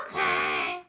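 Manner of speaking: a big low puppet answering; deep puppet voice. Acted. Another puppet agreeing to Libby, deep low voice.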